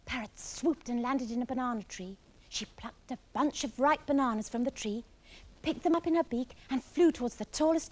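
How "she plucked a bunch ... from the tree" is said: ordinary delivery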